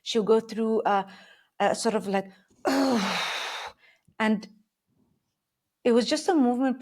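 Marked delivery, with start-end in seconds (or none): 2.6-3.7 long, audible breath out; strong exhale. Retold or imitated. Coach showing her client's breathing during arm work, strong exhale. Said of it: "strong exhale".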